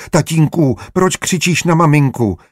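put-on high child's voice, close to tears; a little boy starting to cry; whimpering little boy. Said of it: "Malý Ignác začal hned natahovat". (plačtivý dětský hlas, o dost výš)

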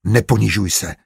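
understated hateful rebuke; hateful hiss. A narrator's reading. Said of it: "sykl nenávistně Matyáš".

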